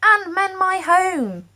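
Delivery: high, loud, slow protest cry; crowd shouting. A narrator's reading. Crowd cries to the giants for their home. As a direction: stretch out the plea, loud and high. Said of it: "began to shout".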